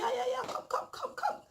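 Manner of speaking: squeaky high imitation of an excited auntie; imitating auntie. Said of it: "she'll be like".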